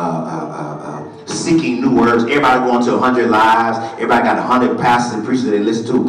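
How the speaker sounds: usual speaking voice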